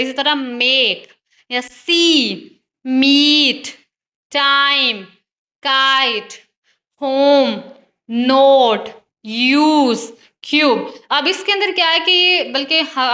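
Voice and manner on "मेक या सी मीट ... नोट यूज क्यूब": each word alone with its vowel stretched, as in मीट, होम, नोट; teacher drawing out every vowel, word by word; long vowels. Shown as a demonstration. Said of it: "लंबा sound जो है वो produce करें". (हर शब्द का स्वर लंबा खींचकर)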